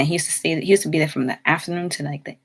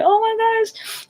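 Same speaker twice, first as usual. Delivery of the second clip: very high, slow, swooning oh my gosh; blushing squeal; slower than usual. Retold or imitated. Seeing her ex-boyfriend at the store, with a high blushing squeal. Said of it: "all blushing and, like, laughing".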